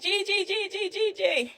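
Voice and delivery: put-on high excited child voice; boy runs to Gigi, high and eager; little boy calling. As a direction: call out like a small boy greeting granny. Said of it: "The little boy was like".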